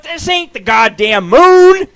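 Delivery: high, loud, slow cry of disbelief; stunned shout at the telescope. Retold or imitated. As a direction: yell it like you just found the wrong target. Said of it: "I'm like".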